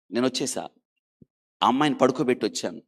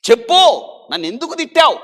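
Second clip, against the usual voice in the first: a hurt patient yelling for an answer; demanding shout; 12 semitones higher, 11 dB louder than usual. Retold or imitated. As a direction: shout the question high and loud. Girl demands answer from nurse.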